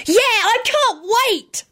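put-on high, slow drawn-out child's holler. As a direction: bellow it with excitement. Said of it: "Tim hollered".

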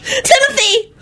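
high calling mother's voice. A narrator's reading. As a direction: call the boy's name sharply. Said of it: "character voice".

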